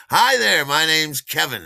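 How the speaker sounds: big, loud, high hello; hearty greeting. Retold or imitated. Man in a suit introducing himself, loud and hearty. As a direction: boom out an over-friendly introduction.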